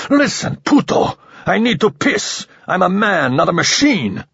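high, shouted outburst at the alien; losing control, yelling insults; furious outburst. A narrator's reading. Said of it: "He heard his voice rising again".

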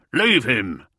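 loud barked order; yell. A narrator's reading. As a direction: shout the command urgently. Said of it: "Brom yelled".

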